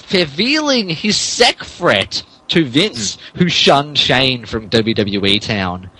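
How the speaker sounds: normal talking voice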